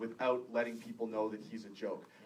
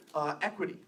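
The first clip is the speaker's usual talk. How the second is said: ordinary delivery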